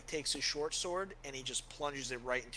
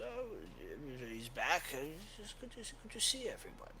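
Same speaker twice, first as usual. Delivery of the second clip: put-on high, frail old cleric fading to a whisper; a tired old father losing his train of thought; weary old priest; 3 semitones higher, 5 dB quieter, slower than usual. Old priest greeting returning adventurers, trailing into a whisper.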